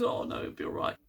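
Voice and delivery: soft unvoiced reassurance; comforting whisper. Book hugging crying Tarka, soft whisper. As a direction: whisper comfort during a hug. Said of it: "And says".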